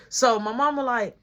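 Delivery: ordinary talk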